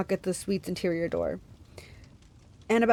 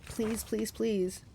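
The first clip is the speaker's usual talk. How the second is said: soft, desperate repetition; hushed muttering, fading; muttered pleading; 7 dB quieter, much slower than usual. A narrator's reading. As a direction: beg under the breath. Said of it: "he muttered".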